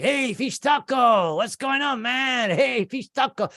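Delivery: high, friendly hail of a regular, stretched out; hammed-up high greeting; restaurant guy greeting. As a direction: call out like a cheery counterman. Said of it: "they're like".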